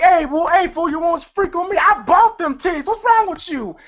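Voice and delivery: high-pitched quarrelsome outburst; mock indignant. Elroy confronting Pinky on the dance floor, mock indignant. Acted quarrel after bumping into someone.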